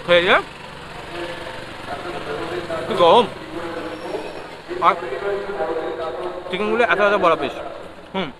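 chewing while talking; talking between bites; mouth full. Real, not acted. Seen 1: Puts momo in mouth, then keeps chewing while talking.

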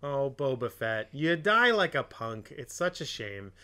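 ordinary talk, nothing marked

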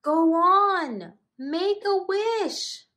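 very high, bubbly burbling voice; burbling fish. Acted. Fish urging the birthday wish, high burbling voice. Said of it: "burbled the fish".

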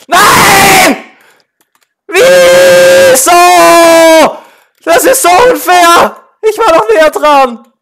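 screaming high and loud; furious shout. Real, not acted. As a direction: yell in outrage at losing. Gamer loses round, shouting.

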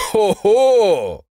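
loud, high jeer; drawn-out "hoho" from a troop of soldiers; high, booming mocking call; company calling out. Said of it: "rief die erste Kompanie".

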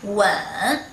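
"Wen" drawn out, dipping then rising; third tone. Shown as a demonstration. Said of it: "And here, third tone".

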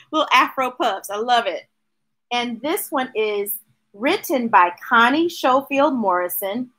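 plain reading voice

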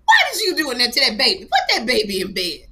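high, loud laughing scold as the mom; a mother laughing while scolding her son. Retold or imitated. Mother scolding her son for bringing the crying girl home. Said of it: "his mother goes".

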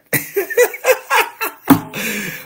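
high, loud laughing fit; loud, high burst of laughter; hearty laugh. Real, not acted. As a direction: crack yourself up. Laughing at own accent joke.

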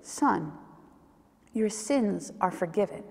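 soft, low, gentle reassurance; hushed, tender forgiveness. A narrator's reading. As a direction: say it tenderly, with space between phrases. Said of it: "so tenderly".

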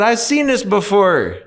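"I've seen this before" pitched well up. Retold or imitated. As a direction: pitch it up, knowing and amused. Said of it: "I said".

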